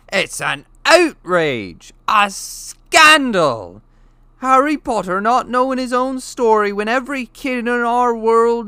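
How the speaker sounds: loud, slow furious outrage. A narrator's reading. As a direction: thunder with fury. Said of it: "jumping up so angrily".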